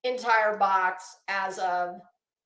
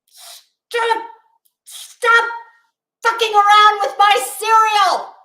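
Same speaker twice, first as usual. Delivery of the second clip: high, loud outraged shout at the cereal box; furious yell; 10 semitones higher, 9 dB louder, slower than usual. Retold or imitated. Alone with the cereal box, a furious high shout. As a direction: yell it like the cereal betrayed you. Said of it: "And I was like".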